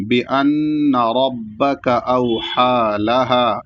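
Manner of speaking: slow chant with nasal نّ in بِأَنَّ; Quran recitation. Shown as a demonstration. Teacher modelling the verse, slow chant. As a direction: recite slowly, nasalising the doubled ن. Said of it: "اس طریقے سے".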